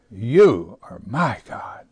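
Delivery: slow and reverent, swelling on "You"; stress on "You". Shown as a demonstration. Preacher repeating the better reading of the verse, stress on "You". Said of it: "a better way is to say".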